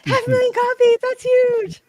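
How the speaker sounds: very high, loud celebratory squeal; over-the-top high-pitched celebration; mock celebration cheer. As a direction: shriek the good news like a party cheer. Staged celebration of the sales figure.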